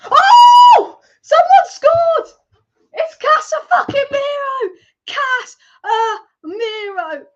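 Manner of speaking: huge high shout of joy; ecstatic goal scream. Real, not acted. Fan watching the match screams over the goal, ecstatic. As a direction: scream it like your team just scored.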